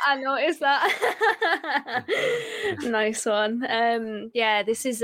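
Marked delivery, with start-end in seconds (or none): 0.1-2.9 slow and high, laughing through it; drawn out with a real laugh. Real, not acted. Repeats the line while laughing.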